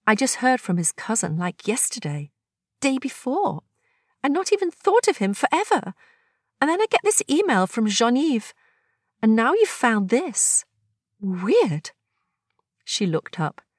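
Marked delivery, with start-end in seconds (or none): 0.1-11.9 higher voice, wistful surprise; Agnes, nostalgic. Acted. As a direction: recall a long-lost memory. Agnes telling the strange news.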